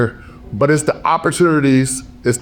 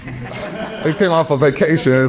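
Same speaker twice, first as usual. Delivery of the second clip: laughing; 3 semitones higher, slower than usual. Real, not acted. Laughs at own emotion.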